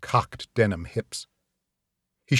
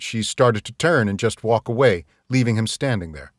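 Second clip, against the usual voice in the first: ordinary delivery, nothing marked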